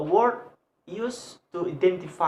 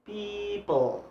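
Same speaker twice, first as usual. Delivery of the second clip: "people" with a long PEE, then falling; drawn-out stressed first syllable in "people"; 3 semitones higher than usual. Shown as a demonstration. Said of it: "P have a stress".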